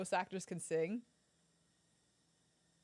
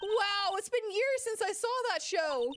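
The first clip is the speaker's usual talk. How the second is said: very high, loud nostalgic wow; high, loud, starry-eyed; delighted gush; 11 semitones higher, 5 dB louder than usual. Acted. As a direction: burst out thrilled. Character excited about show.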